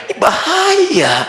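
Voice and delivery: preacher stresses danger to the listeners, slowly; emphatic warning. Real, not acted. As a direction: draw out bahaya slowly as a warning. Warning the congregation.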